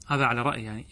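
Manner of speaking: ordinary delivery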